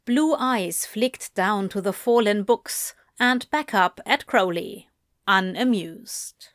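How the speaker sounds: usual speaking voice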